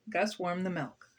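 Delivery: ordinary delivery, nothing marked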